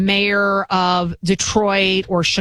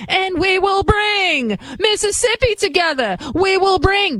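mock Southern Baptist preacher; 11 semitones higher than usual. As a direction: ham up a Southern preacher accent, pitch up. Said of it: "Now she's become a Southern Baptist preacher".

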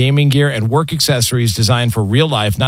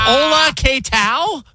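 mock Spanish greeting; 11 semitones higher, slower than usual. Joining the Spanish bit.

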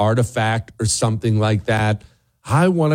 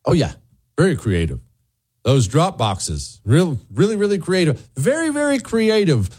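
sarcastic; 6 semitones higher than usual. Acted. Sarcastic praise of cheating.

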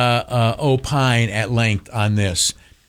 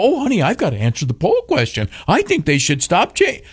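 mock viewer; 6 semitones higher than usual. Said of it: "and you go".

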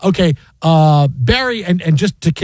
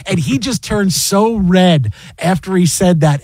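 ordinary talk

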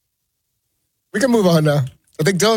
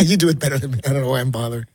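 normal talking voice

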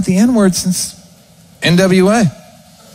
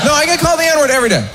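higher, fast, insisting; emphatic insistence; 8 semitones higher, faster than usual. Real, not acted. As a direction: push the claim hard.

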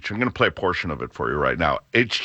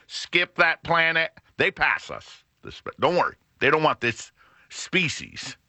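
alien voice; 5 semitones higher than usual. Pretend. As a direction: speak as a goofy alien from above. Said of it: "Your alien voice is killing me".

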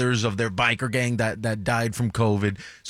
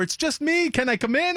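high, sing-song reassurance through a door; coaxing manager. Retold or imitated. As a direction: coax him to open up. Manager at locked green room door.

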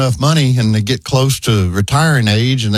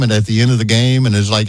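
usual speaking voice

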